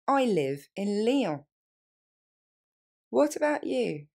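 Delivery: slow, careful model phrases; slowly. Shown as a demonstration. Teacher repeating slowly for students. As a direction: give learners every word. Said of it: "On reprend lentement".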